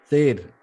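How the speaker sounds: "शेर" said as "ser"; tongue forward, s opening "शेर"; S-for-SH demo. Shown as a demonstration. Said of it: "correct is the placement of tongue". ("शेर" को "सेर" बोलें)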